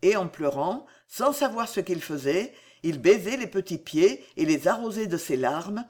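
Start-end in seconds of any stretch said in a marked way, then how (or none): none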